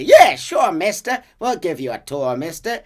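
put-on high, loud, eager kid voice; cheeky street kids. Street kids hustling Arthur into a tour, high and loud. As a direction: sell the tour like a scheming urchin.

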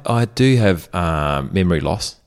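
usual speaking voice

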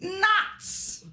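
put-on very high little-kid "Nuts"; child's voice. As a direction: squeak "Nuts" like a small boy. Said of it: "He goes".

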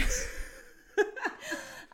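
high-pitched laugh; real high laughter; amused laughter. Real, not acted. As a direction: burst into high giggles. Laughing after the blunt insult.

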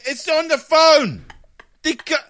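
high, loud incredulous complaint; exasperated protest. Retold or imitated. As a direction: protest to the boss in disbelief. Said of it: "And I'm like".

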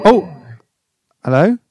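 ordinary talk, nothing marked

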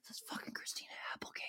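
fast, hushed starstruck whisper; excited whisper. Retold or imitated. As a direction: whisper it fast so she can't hear. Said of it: "I was like".

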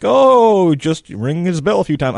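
loud, high mocking shrug-off; dismissive NFL official. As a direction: brush it off like a careless league official. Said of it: "where they're like".

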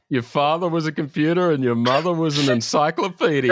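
high, loud mock taunt; acted insult. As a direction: hurl it as a hammy insult. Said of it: "there's a lot of, yeah".

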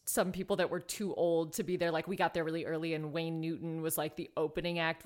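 ordinary delivery

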